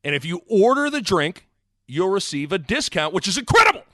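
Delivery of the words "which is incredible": higher, louder burst of sales hype; over-excited. Acted. Host overselling a sponsor discount, over-excited. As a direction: blow up on the last word of the pitch. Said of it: "Calm down".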